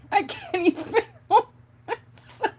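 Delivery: high, loud words through real laughter; can barely speak for laughing; laughing fit. Real, not acted. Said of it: "I have to gain my composure".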